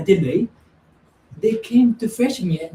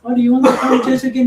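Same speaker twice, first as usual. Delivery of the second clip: fast, loud, high mock invitation; eager church friend; 7 semitones higher, 7 dB louder, much faster than usual. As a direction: rush it out laughing. Imitating church people's invitation.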